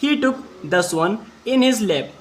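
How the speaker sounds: very slow English, each word apart; slow dictation. Shown as a demonstration. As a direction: read it slowly so pupils can follow. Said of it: "आगे पढ़ते हैं". (धीमी गति से शब्द-दर-शब्द पढ़ना)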